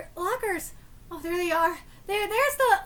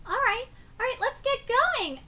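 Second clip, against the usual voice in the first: ordinary talk, nothing marked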